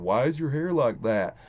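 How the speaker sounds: mimicking kids' questions in a Vermont accent; quoted question in a mock Vermont accent; imitated Vermont accent. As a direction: put on a Vermont schoolkid accent. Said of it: "I don't know why they had that accent".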